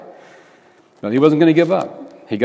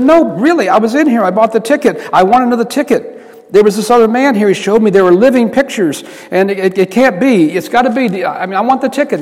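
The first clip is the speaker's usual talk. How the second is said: much higher and louder, desperate; a panicked man begging for his ticket; pleading with the owner, high and urgent; frantic pleading; 8 semitones higher, 4 dB louder than usual. Retold or imitated.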